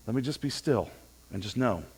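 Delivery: soft, hushed, settled after a sigh; a quiet, peaceful resolve; calm stillness. Shown as a demonstration. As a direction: breathe out and speak it gently. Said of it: "calm ourselves down".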